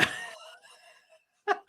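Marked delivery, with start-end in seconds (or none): 0.0-0.1 high, helpless laughing voice; real giggling disbelief; cracking up. Real, not acted. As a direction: gasp it out between fits of laughter. Cracking up at the impression.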